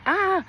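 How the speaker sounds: short high mock yell; imitated pain yell. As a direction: re-enact a startled yell of pain, held back in volume. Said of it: "yelled like".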